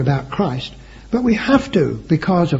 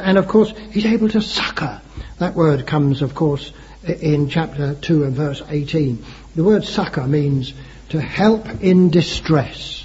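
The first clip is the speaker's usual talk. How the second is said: normal talking voice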